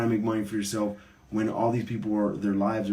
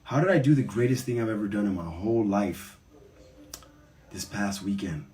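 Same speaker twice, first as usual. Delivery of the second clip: usual speaking voice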